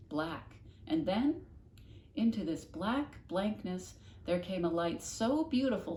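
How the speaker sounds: plain reading voice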